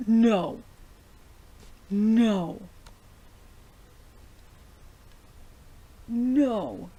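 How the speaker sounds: booming drawn-out cries of no; appalled, forceful outcry; loud refusal. Real, not acted. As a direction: shout each no in real dismay. Shocked reaction to revival.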